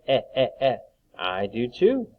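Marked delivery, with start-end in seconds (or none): 0.1-0.8 three separate "Eh" sounds; short E sound. Shown as a demonstration. Teacher asking pupils to hear short E, repeated "Eh". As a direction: repeat "Eh" as in bed, evenly spaced. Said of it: "You hear the E sound?".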